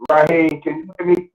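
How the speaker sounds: muffled, like in a box. Real, not acted. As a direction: voice boxed in on speakerphone. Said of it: "sound real muffled, like you're in a box".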